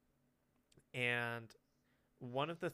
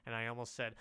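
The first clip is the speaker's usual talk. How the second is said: normal talking voice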